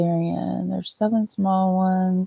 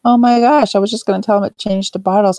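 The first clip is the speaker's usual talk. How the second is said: startled, higher and louder, fast; surprised exclaim; 5 semitones higher, 4 dB louder, faster than usual. Retold or imitated. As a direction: blurt it out in shock. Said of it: "and he's like".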